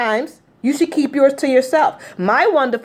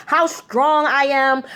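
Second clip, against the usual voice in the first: normal talking voice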